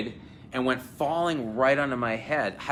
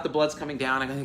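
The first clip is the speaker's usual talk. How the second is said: ordinary delivery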